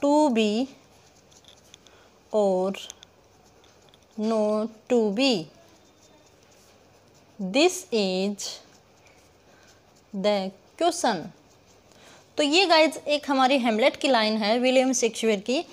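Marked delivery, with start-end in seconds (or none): 0.0-11.2 word by word with long pauses; measured, halting example read-out; slow dictation. Shown as a demonstration. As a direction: spell out the line slowly, as if writing it. Slowly dictating the example line.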